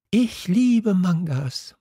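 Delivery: put-on high, slow giggly girl's line; giggling. A narrator's reading. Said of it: "sagte das Mädchen unvermittelt mit einem Kichern".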